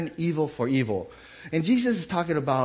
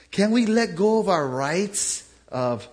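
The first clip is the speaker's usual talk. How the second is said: slow, louder pleading challenge; slow earnest plea; 5 semitones higher, 5 dB louder, slower than usual. Real, not acted. Pastor challenging congregation to let go. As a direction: press the question slowly. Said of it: "preacher pleads slowly".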